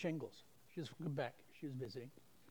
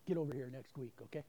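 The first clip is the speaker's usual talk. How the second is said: ordinary delivery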